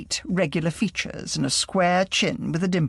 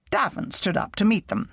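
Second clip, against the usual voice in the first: ordinary delivery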